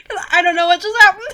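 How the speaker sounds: high, loud, fast through real laughter; cracking up in bafflement; laughing. Real, not acted. Cracks up after reading the scene.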